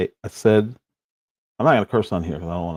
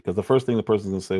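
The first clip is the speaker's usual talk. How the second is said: normal talking voice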